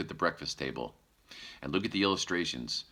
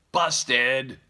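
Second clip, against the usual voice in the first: put-on high, loud little baby voice; baby bear voice; 8 semitones higher, 8 dB louder than usual. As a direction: chirp it like a happy toddler.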